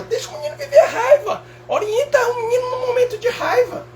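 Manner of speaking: high whiny mocking voice; mock doting parent. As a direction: whine it in a sing-song parody. Mocking indulgent parenting advice.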